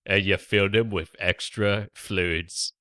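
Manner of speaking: nasal hobgoblin take on Michael Caine; ogre-like Michael Caine impression. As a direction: put on a bloated ogre voice. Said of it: "your sort of ogre voice".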